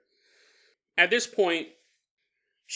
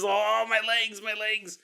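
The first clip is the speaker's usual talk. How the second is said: high mock moan; lightly voiced complaining ghost; 5 semitones higher, slower than usual. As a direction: joke whine of a squashed ghost. Jokes as squashed ghost.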